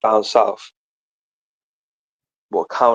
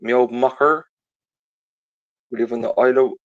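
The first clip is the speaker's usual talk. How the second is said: slipping into Irish accent. Shown as a demonstration. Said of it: "I'm going Irish again".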